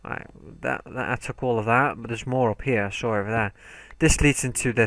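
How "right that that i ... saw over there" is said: ordinary delivery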